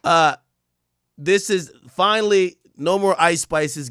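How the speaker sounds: ordinary delivery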